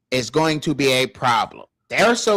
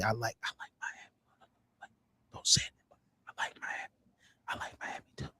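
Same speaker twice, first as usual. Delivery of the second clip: whisper; 6 semitones lower, 18 dB quieter than usual. Pretend. Sneaking a rival team confession.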